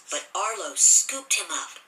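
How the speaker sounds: ordinary delivery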